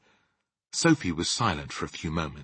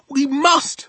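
high, loud insistent cry; urgent cry; 10 dB louder than usual. Acted. Sophie insisting to the giant that they act, urgent cry. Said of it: "Sophie cried".

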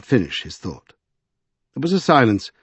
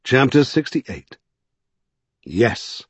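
plain reading voice

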